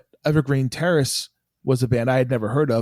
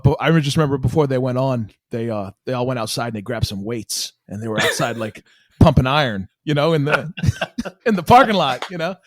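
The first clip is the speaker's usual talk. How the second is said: usual speaking voice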